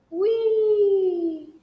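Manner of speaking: high loud gleeful whee; excited. Acted. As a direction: sing it out high with glee. Swinging down on a vine.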